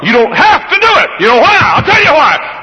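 high and loud; preaching cry. Acted. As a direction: burst out with the answer. Preaching at full force.

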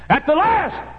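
high, loud preacher's cry; shouted refrain. Real, not acted. Preacher warning sinners of the end, sudden shout.

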